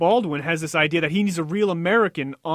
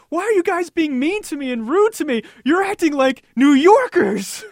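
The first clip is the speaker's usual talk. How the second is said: much higher and louder, a hurt complaint; whiny comedian imitation; 9 semitones higher, 7 dB louder than usual. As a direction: play the recruited comic, stung by the crowd, pitched way up. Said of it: "It's like".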